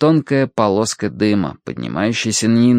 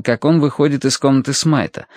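usual speaking voice

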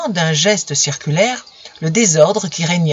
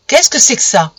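higher and louder accusing question; accusing demand; 5 semitones higher, 5 dB louder than usual. Acted. Camille confronting Romain with the letter, angry demand. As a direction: thrust the question at him.

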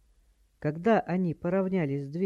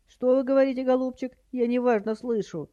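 high, loud hard-of-hearing granny; high-pitched dotty old lady; batty old woman; 8 semitones higher, 5 dB louder than usual. A narrator's reading. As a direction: pipe up as if half deaf. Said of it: "прекрасно изобразила полоумную старуху".

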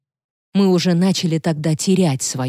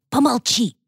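high, urgent hushing hiss; hissed command; 7 semitones higher than usual. A narrator's reading. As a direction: shush her sharply, pitched well up. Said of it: "прошипел он моё собственное имя". (высокий шипящий приказ замолчать)

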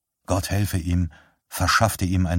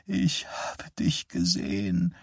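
softer, slow, near whisper; hushed old mother; 7 dB quieter, slower than usual. Acted. Dying mother tells her son she saw him, hushed. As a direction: murmur it close. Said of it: "flüsterte sie".

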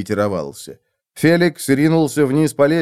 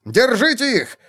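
high barked command; high shout; 11 semitones higher than usual. Acted. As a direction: yell it at the men. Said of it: "закричал своим людям".

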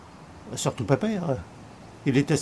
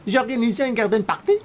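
mimicking her in a much higher, slowed voice; mocking the hostess; 11 semitones higher than usual. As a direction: throw her snub back at her in a high mock falsetto.

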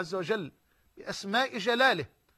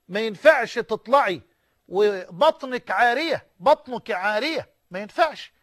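louder, scolding mother forbidding her daughter to go out; 4 dB louder than usual. Retold or imitated. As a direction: bark out the rules like a strict mother. Mother scolding daughter about clothes. (أمّ غاضبة تزجر ابنتها بصوت أعلى)

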